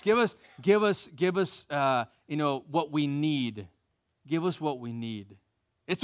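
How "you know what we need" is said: ordinary talk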